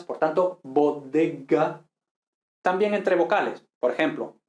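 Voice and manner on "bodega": "bodega" slowly, each B, D and G a hard stop; slow "bodega" with almost doubled stops; fully plosive consonants. Shown as a demonstration. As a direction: close firmly on D and G in "bodega".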